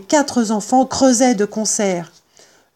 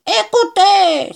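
high, loud, drawn-out shout; furious cry; 12 semitones higher, 5 dB louder, slower than usual. Acted. Bean silences the other two farmers, furious shout. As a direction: explode to seize their attention. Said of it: "s'écria-t-il furibond".

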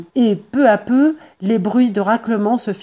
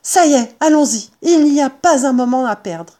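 high, loud urgent rallying; crying out; 5 semitones higher, 5 dB louder than usual. Acted. Mr Fox rallies his family to act, crying out. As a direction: jump in with sudden resolve. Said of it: "Il fit un bond et s'écria".

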